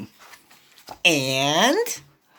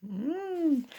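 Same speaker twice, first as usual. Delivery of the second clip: ordinary delivery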